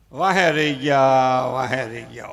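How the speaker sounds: low, slow mock wail of missing out; a greedy man mocked for missing out, slow mock lament. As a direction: moan like a greedy man left behind.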